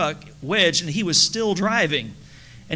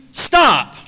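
shouted stop; 7 semitones higher, 10 dB louder than usual. Retold or imitated. Re-enacting the worker's stop shout.

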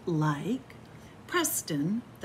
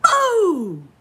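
high loud BOO; shouted boo; 11 semitones higher, 11 dB louder than usual. Acted. Reader delivering the book's last scare, loud. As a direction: jump out and yell it.

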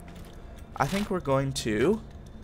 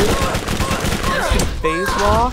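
high, loud mocking; taunting an escaping enemy; gloating taunt; 9 semitones higher, 5 dB louder than usual. Real, not acted. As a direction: taunt him from above.

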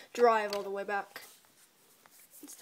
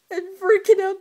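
higher and much louder, carried away; excited; 9 semitones higher, 14 dB louder than usual. Real, not acted. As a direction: burst out with it. Excited retelling.

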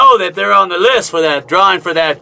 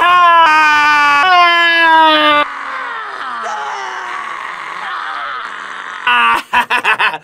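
long, high cackling laugh; laughing at himself; 7 semitones higher than usual. Real, not acted. After yelling a cowboy howdy to patrons, laughing hard. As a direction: crack up after the yell. After his yeehaw shout.

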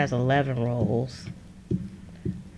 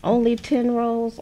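high-pitched teasing disbelief; mock disbelief; 8 semitones higher, 3 dB louder than usual. Washi owner ribbing a viewer with few rolls, mock disbelief. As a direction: tease with a high, incredulous question.

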